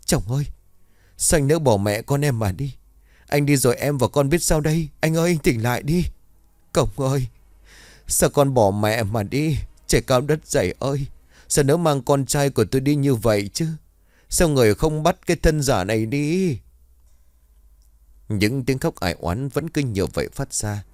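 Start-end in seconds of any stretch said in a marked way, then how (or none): 0.0-6.0 high, keening wail over her husband's body; wailing grief. A narrator's reading. Wife wailing over her husband's corpse, keening lament. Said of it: "rồi gào lớn". 6.7-16.6 wailing lament. A narrator's reading. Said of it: "rồi gào lớn".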